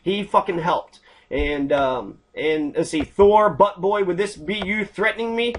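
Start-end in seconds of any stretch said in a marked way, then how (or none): none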